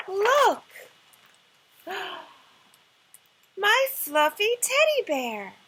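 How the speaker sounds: very loud, high delight with a gasp; delighted show. Acted. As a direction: present it like treasure. Princess shows teddy excitedly.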